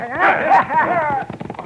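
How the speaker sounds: put-on gleeful old man's chuckle; high amused chuckle. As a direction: laugh at the outlaws left behind. Doc laughs at leaving them.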